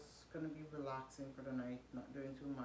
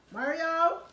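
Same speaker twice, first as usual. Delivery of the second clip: loud, high yell across the house; calling out; 12 semitones higher, 16 dB louder than usual. Real, not acted. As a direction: shout the name to fetch him. Calling a child from afar.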